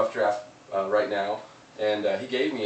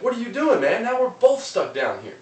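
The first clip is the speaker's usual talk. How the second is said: incredulous dismay; 11 semitones higher, 6 dB louder than usual. Retold or imitated. Man in hole reacts to dude jumping in, high incredulous voice. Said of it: "And the guy's like".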